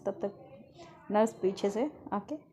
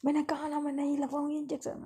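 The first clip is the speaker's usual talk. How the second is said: put-on higher, fast defiant girl; angry refusal; 6 semitones higher than usual. Girl yelling at the returning nurse before throwing a pillow, defiant. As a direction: snap back in defiance. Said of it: "कहती है".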